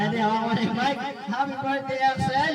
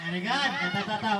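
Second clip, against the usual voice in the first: normal talking voice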